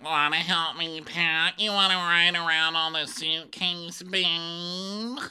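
high, slow, sweet-talking mother; cooing mom voice. Retold or imitated. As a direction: coax a child in a syrupy voice. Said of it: "so Mia's like".

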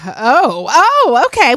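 rising, loud mock surprise; surprised oh. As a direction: act delighted and surprised. Scripted ad, hot dog talk.

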